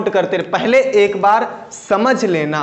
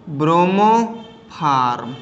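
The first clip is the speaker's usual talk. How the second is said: drawn out slowly; 4 semitones lower, much slower than usual. Shown as a demonstration. Teacher reveals the common name.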